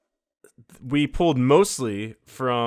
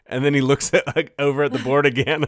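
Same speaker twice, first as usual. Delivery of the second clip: ordinary talk, nothing marked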